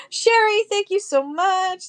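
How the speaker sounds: high, bright call of thanks with a laugh; excited greeting. Real, not acted. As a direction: greet her with a delighted squeal. Thanking a live buyer.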